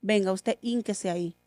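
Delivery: softly, calmly reassuring; a quiet, gentle father's promise; soft and serene, no anger at all. Retold or imitated. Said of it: "con toda la serenidad".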